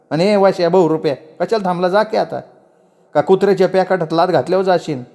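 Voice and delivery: high-pitched, furious aunt; scolding chachi. Acted. Aunt forces leaves on the girl and chases him off, furious.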